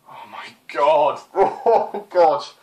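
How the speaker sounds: slow, laughing groan; laughing in disbelief. Real, not acted. Laughing at the bad dialogue.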